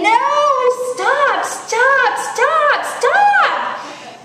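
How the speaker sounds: high, loud cries, climbing with each one; mock screaming. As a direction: scream for the tickling to stop, acting it out. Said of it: "eventually I'm screaming".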